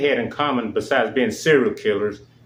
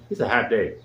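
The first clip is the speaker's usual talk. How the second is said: ordinary talk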